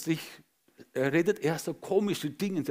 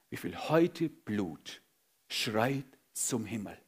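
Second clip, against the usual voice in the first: softer, slow, grave question; hushed question; 6 semitones lower, 6 dB quieter, slower than usual. Acted. Preacher asking church about spilled blood, hushed. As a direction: ask it quietly and gravely. Quiet question then repeated louder.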